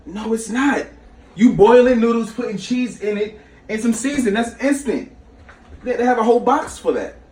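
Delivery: hammed-up outrage at a friend's macaroni; heated retold rant. Arguing over baked macaroni.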